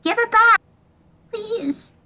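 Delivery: high-pitched plea, softening on please; background voice. Acted. Voice in the background of a call demanding it back, high-pitched plea. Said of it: "hear clearly in the background".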